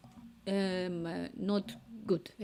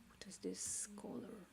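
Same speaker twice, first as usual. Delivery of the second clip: whisper; 2 semitones higher, 16 dB quieter than usual. Real, not acted. Whispering while choosing colors.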